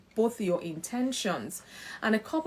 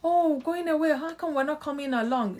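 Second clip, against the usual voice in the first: put-on whiny child; 7 semitones higher, 4 dB louder than usual. Parent voicing a disappointed child.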